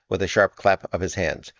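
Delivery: ordinary delivery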